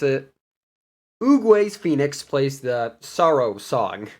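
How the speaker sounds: usual speaking voice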